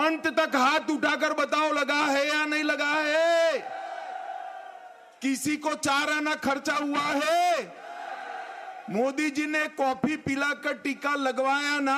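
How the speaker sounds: shouting questions to a crowd; booming political rally speech. Real, not acted. Asking crowd to raise hands.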